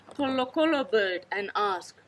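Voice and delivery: ordinary delivery, nothing marked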